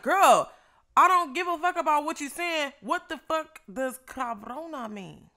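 loud, angry retold demand; went off. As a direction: snap at her to explain the word. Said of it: "And I'm like".

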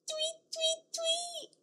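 high soft tweet tweet; bird tweets. Acted. Teacher chirping bird calls for toddlers. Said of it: "Can you do it?".

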